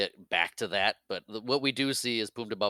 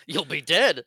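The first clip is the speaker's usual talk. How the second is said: loud, high put-on menace; mock threat; 7 semitones higher, 9 dB louder, slower than usual. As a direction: throw out a hammed-up threat. Retold cantina threat, acted.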